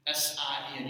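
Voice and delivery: ordinary delivery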